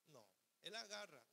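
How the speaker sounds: ordinary talk, nothing marked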